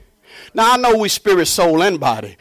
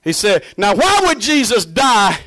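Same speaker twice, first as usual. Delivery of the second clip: usual speaking voice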